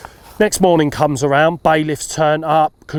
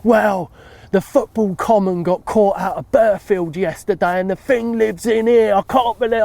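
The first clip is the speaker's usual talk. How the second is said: indignant bailiff; 6 semitones higher than usual. Retold or imitated. Bailiff complaining the fish was caught elsewhere, higher and outraged. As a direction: pitch it up in disbelief.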